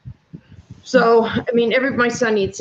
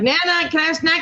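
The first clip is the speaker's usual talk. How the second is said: put-on lightly raised little-kid voice asking for a snack; imitating grandkid; 7 semitones higher than usual. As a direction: play the eager grandkid, pitched up a bit.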